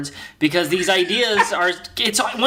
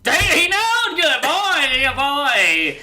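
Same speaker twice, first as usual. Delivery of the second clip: excited dog praise; 5 semitones higher, much slower than usual. Acted.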